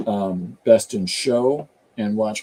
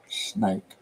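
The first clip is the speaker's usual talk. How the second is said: softer Appalachian twang; Appalachian accent; 4 semitones lower, 5 dB quieter than usual. Shown as a demonstration. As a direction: let it fall quietly in Appalachian. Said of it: "That's the accent that was going through my head".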